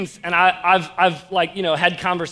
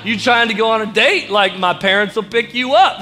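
louder, slightly higher eager pitch; excited teen guy; 3 semitones higher, 7 dB louder than usual. Acted. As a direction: play an eager teen asking someone out. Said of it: "we get all excited".

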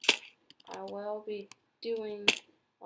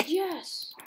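high, louder yes of joy; quick cheer; 9 semitones higher, 5 dB louder than usual. Real, not acted. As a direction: pop it out happily. Brief success in game.

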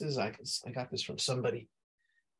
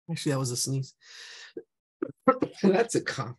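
ordinary talk, nothing marked

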